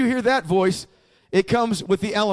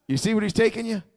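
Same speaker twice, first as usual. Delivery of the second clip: ordinary talk, nothing marked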